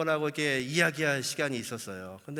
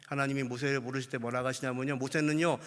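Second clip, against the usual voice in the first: ordinary delivery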